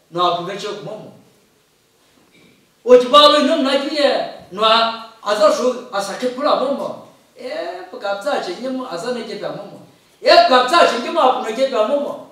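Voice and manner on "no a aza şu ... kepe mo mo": usual speaking voice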